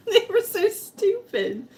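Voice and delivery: squeaky laughing complaint. Real, not acted. Mocking the old timecard rule, laughing high.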